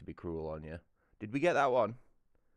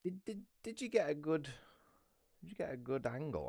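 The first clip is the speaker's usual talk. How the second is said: high eager question; 10 semitones higher than usual. Real, not acted. Asking about camera angle.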